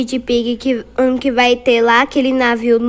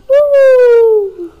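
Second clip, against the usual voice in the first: cheering; 9 semitones higher, 7 dB louder, much slower than usual. Real, not acted. Celebrating the escape.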